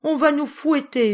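high, loud panicked wail; howling. A narrator's reading. The children howling that they will be whipped, high and loud. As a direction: howl in terror of punishment. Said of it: "hurlèrent-ils".

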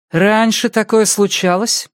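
brisk questioning doctor; female doctor voice. Acted.

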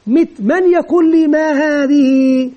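slow prompt with stretched final vowel; drawn-out classroom questioning; teacher's class question. Shown as a demonstration. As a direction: ask pupils slowly, drawing out the question. Teacher modelling questions to pupils.

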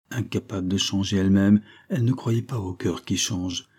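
ordinary delivery

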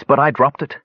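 plain reading voice

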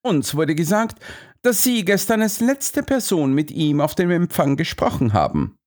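high-pitched, formal Holmes; questioning detective. A narrator's reading. Detective confronting Stanley about the reception, formal. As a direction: put it to the witness in a high voice. Holmes voiced in read story.

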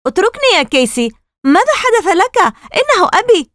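very high, heated protest; angry cry. Acted. Margaret to the brother holding her back, angry cry. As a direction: protest furiously, pitch soaring. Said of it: "صرخت مارغريت بغضب".

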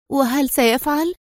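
a touch of acted fear; fearful question. A narrator's reading. Woman asks whether he will report them, mildly fearful. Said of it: "سألته وهي ترتعد بردا وخوفا".

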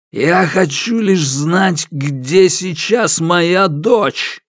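insistent demand. A narrator's reading. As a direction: spell it out slowly and hard. After slapping the sofa.

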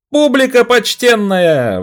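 very high, loud, slow yell; yelling at the top of lungs. Acted. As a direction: bellow it to the crowd. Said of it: "Вопю во всё горло".

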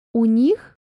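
громкое удивлённое переспрашивание; переспроси с недоумением; удивлённо. A narrator's reading. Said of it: "удивилась". (учительница удивлённо переспрашивает Аню)

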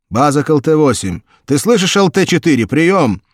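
loud, high urgent radio call; radio command. A narrator's reading. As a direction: call out to the lost crew. Base calling pilots.

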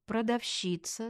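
usual speaking voice

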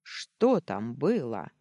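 slow, drawn-out interrogation; threatening growl. A narrator's reading. Daniil leaning over the heroine, threatening growl. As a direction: demand it word by menacing word. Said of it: "прорычал он".

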